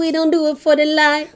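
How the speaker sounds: put-on Jamaican accent, song lyric quote; lilting Jamaican accent. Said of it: "We tried our best with my Jamaican accent".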